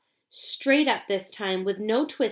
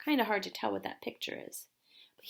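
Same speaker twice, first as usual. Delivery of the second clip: whispered aside; 8 dB quieter, faster than usual. Real, not acted. Reader's aside over book picture.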